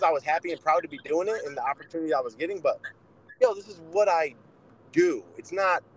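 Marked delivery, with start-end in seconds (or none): 3.4-5.2 yo pitched much higher, then a firm landing on do; emphatic insistence. Real, not acted. Telling his podcast partner boxing isn't negotiable, emphatic. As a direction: insist it like it's not up for debate. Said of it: "to me, it's not negotiable".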